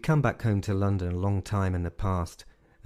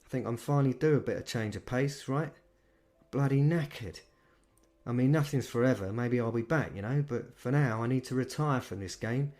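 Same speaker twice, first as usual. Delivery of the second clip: put-on higher, chatty London accent; weary old boss talking retirement; London manager; 5 semitones higher than usual. Manager chatting to the vampire, easy London accent. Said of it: "He had a London accent".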